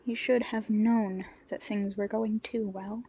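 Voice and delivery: plain reading voice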